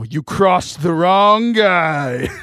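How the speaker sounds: slow, drawn-out mock threat; joking returned Jesus. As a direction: deliver the punchline slowly, stretching each word. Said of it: "he would probably say something".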